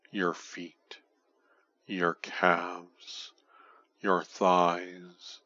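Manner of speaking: usual speaking voice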